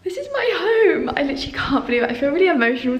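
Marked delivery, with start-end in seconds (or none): 0.0-1.1 much higher, breathless wonder; high, amazed declaration; awed delight. Retold or imitated. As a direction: relive the moment you walked in. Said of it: "I was like".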